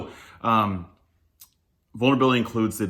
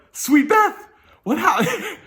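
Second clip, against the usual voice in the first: much higher and louder, aghast; stunned disbelief; 7 dB louder, much slower than usual. Retold or imitated. Retelling his reaction to the counselor's swear, high stunned squeak. Stunned by Beth's blunt words.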